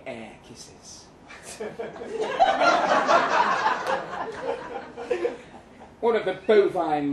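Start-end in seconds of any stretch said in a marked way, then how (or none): 1.3-5.6 whispered laughing puffs, much higher; airy puffing laugh. Acted. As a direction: puff out silly air kisses with a laugh. Narrator mimics pipe-smoking air kisses.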